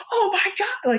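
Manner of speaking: squealing fan. Retold or imitated. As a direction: imitate a screaming teen fan. Imitating screaming young fans.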